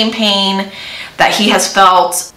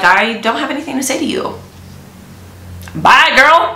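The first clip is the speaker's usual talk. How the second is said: higher, fast, dismissive kiss-off; sassy brush-off; 6 semitones higher than usual. Retold or imitated. Hector dismisses Valerie after her offer, sassy and higher. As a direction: flick her away with a sassy bye.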